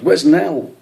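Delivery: loud, high frantic call; alarmed shout. A narrator's reading. Brian realising Nell is missing, frantic shout. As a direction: cry out for her, panicked. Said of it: "he shouted".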